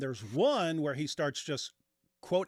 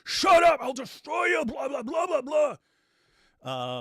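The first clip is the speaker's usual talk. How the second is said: much higher, much louder hammed-up yell; mock furious shout; 12 semitones higher, 9 dB louder, slower than usual. Host mimics the preacher silencing a critic, hammed-up furious shout. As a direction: bellow it like a bully shutting down critics. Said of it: "And if you even slight".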